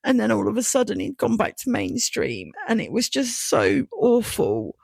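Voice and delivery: real tears rising as she speaks; emotional. Real, not acted. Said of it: "I wasn't expecting this one to be this emotional".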